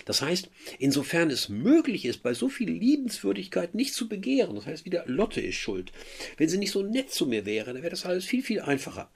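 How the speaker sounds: high, ironic Werther voice; mock his self-pitying excuse; mocking paraphrase. Teacher parodying Werther blaming Lotte, high and ironic.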